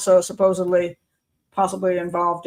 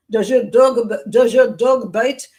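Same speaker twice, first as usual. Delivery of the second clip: louder, slow, hammed-up question; mock Peter Sellers; 2 semitones higher, 7 dB louder, slower than usual. Retelling the Pink Panther joke, hammed-up slow and loud. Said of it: "it's Peter Sellers that I said".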